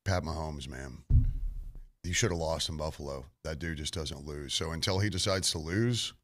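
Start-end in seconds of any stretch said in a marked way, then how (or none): none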